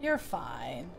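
ordinary talk, nothing marked

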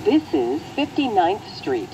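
usual speaking voice

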